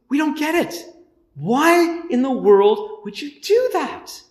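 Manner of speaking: bewildered. Retold or imitated. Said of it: "it's like".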